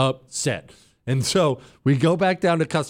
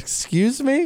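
incredulous; 11 semitones higher, slower than usual. Retold or imitated. Told no flight until Monday.

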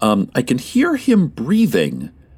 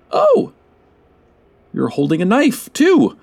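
startled; 9 semitones higher than usual. Real, not acted. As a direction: jump at the sight. Sees intern holding a knife.

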